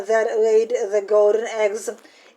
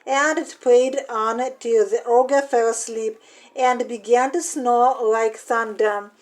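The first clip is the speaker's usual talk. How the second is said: ordinary delivery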